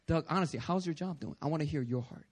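soft, low, earnest asking; sincere question. Shown as a demonstration. God inviting Doug to open up, sincere and soft. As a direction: ask gently, wanting the real answer. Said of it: "It's like".

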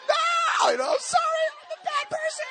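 hammed-up sobbing cry; imitating a tearful breakdown; high, loud mock wail. Breaking down at altar.

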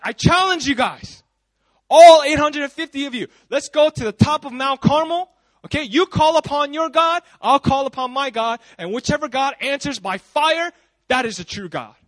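hammed-up defiant prophet; bold challenge. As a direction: throw down the gauntlet to a crowd. Retold prophet challenges the false prophets.

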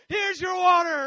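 loud, high angry shout; annoyed. Acted. Impatient Moses giving the people water, annoyed shout. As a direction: hurl it at them, out of patience. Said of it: "He's, like, getting so annoyed".

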